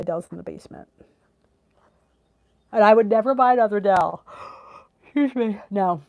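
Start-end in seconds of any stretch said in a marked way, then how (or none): 2.7-6.0 emphatic rant. Real, not acted. Ranting about her Dell.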